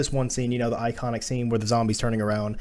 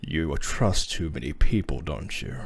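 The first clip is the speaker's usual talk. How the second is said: very low, slow villain voice; deep, quiet menace, unhurried; Wesker; 10 semitones lower, 6 dB quieter, slower than usual. Retold or imitated. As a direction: imitate a cool, sinister villain in a low murmur. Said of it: "to be like this".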